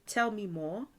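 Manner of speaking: usual speaking voice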